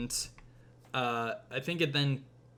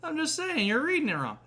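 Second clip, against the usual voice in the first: put-on much higher, whining kid insisting; whiny kid; 14 semitones higher, faster than usual. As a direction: whine it like a stubborn grandson.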